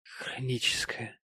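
slow, feeble whisper; barely voiced, weak and grave; solemn, very weak. A narrator's reading. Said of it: "и очень слабым голосом".

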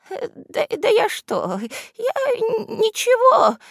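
very high, stammering squeak; flustered backpedalling. A narrator's reading. Henchman retreating before roaring boss, frightened stammer. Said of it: "Тут же пошёл на попятный".

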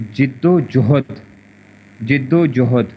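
جد و جہد said slowly as jad-o-jahad; teacher repeats جد و جہد deliberately; careful pronunciation. Shown as a demonstration. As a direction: model جد و جہد for the class, slowly. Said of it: "لفظ ہوتا ہے".

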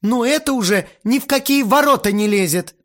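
loud, very high protest; indignant squeal. A narrator's reading. Aunt bursts out at the boy's insolence, squealing indignantly. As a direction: squeal it in outrage. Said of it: "взвизгнула тётя Марина".

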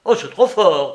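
put-on old man crying out in protest; indignant cry. A narrator's reading. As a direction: cry out, outraged. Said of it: "cria le père Soupe".

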